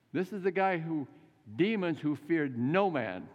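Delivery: normal talking voice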